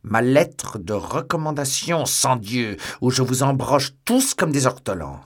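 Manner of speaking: second, fuller furious roar of "lettre"; furious threat. Acted. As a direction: bellow the threat at the whole inn. Threatens innkeeper over stolen letter.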